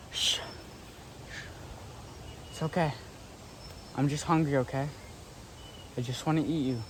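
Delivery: hushed soothing whisper. Pretend. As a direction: coax the animal quietly so it stays put. Soothing the creature quietly.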